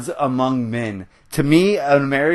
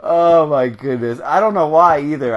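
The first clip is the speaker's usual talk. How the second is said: normal talking voice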